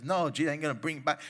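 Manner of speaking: ordinary delivery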